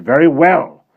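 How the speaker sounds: stern headmistress. Acted. Missus Brisket ordering Bonnie, stern and suspicious. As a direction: order her about and eye the boy.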